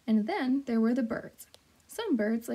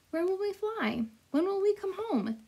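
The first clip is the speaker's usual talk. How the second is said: high, eager little gosling; young gosling voice; 9 semitones higher than usual. Acted. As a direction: ask like a curious little bird. Gosling asks the flock again.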